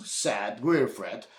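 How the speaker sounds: usual speaking voice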